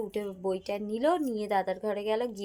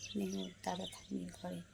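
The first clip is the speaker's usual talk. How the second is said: ordinary talk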